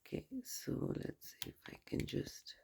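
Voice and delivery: soft whisper while arranging cards. Real, not acted. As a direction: whisper it to yourself. Fiddling with cards off mic.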